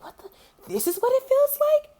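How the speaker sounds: breathless whisper jumping up high; stunned disbelief. Retold or imitated. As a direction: gasp it out, then squeak in amazement. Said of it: "I was like".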